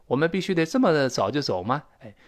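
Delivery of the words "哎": usual speaking voice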